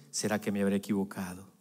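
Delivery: joking mimicry. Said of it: "Yo hago la broma".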